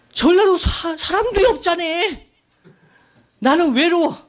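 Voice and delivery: high, loud imitation of a lonely wail; mock tearful outburst. Preacher acting his Jeolla friend pleading with churchmates, tearful outburst. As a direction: cry out the complaint as the hurt friend. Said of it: "울면서 얘기했어요".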